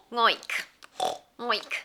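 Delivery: low soft oinking; pig oink. Pretend. Pig sound for toddlers.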